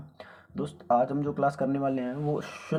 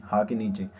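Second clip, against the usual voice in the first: ordinary talk, nothing marked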